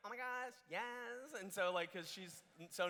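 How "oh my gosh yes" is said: drawn-out, higher-pitched imitation of mom; slow mock-delighted yes, pitched up; agreeable mom. Mom agreeing to lend her car, drawn-out delight. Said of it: "my mom was like".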